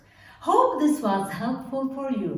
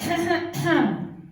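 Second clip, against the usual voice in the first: throat clearing; 8 semitones higher than usual. Shown as a demonstration. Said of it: "this ha is when you clear your throat".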